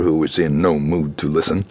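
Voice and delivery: ordinary delivery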